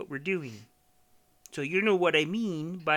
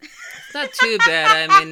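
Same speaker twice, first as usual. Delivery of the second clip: Miss Piggy voice; 16 dB louder than usual. Pretend. Still in Piggy voice.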